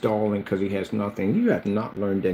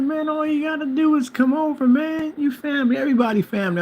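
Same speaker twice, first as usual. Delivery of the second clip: high, animated neighbor voice; imitated welcoming neighbor. Neighbor telling him to come over, high imitated welcome. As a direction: act the buddy insisting the door is always open.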